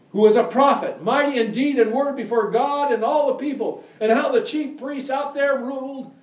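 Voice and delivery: high, ringing pulpit reading; preaching scripture. Acted. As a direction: proclaim the verse to the congregation. Scripture read at full preaching force.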